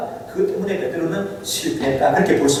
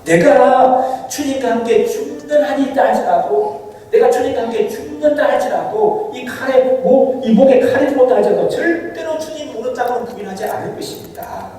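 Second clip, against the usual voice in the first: Peter's vow; 6 semitones higher than usual. Acted. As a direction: swear it like Peter would die first. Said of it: "이렇게 고백하는 베드로의 고백은".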